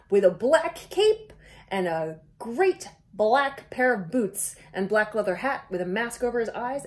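usual speaking voice

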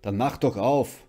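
husband shouting back; loud reply. A narrator's reading. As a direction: shout it back from another room. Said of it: "gab ihr Mann lautstark zurück".

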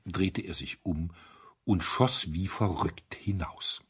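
ordinary delivery

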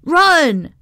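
urgent high yell. A narrator's reading. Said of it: "someone pulled me up by my sleeve and yelled".

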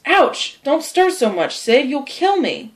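high, pained complaint; feigned pain. Acted. Tom faking illness to his brother, in mock agony. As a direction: protest as if every movement hurts.

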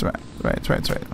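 right, right trailing into an unvoiced whisper; right, right whispered to nothing; fading whisper. Real, not acted. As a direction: let right, right fade away to a whisper. Fading out while playing.

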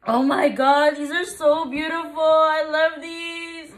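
high, drawn-out adoring wail; real crying with joy; tearful delight. Real, not acted. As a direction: gush through happy tears. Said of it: "I'm crying".

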